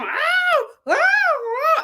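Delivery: high, drawn-out crowd howl; imitated audience whoops. Audience egging Olu on from their seats, high mock whooping. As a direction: mimic a hyped-up crowd hollering.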